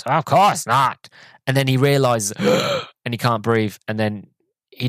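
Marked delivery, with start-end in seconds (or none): 0.0-1.1 slow, higher put-on voice, a touch louder; imitating Joe. Retelling Joe answering Fly in a slow smug voice. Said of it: "And then Joe goes". 2.4-3.0 mock gasp. Joe realizes he can't breathe.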